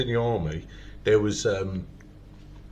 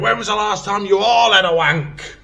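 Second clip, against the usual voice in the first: raised, shouted Scottish corporal voice; Scottish accent; 9 semitones higher, 8 dB louder than usual. Retold or imitated. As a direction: shout at the whole room, Scottish. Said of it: "This is my best Scottish accent".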